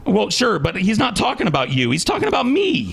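insistent, voice jumping high on the last phrase; heated correction. Real, not acted. Man insisting the insult was aimed at him, heated. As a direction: pitch climbing sharply to the end.

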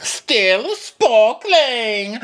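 high, very slow and drawn out, teasing; flirty mock waiter. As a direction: purr the offer like a flirt. Mocking Kyle offering water.

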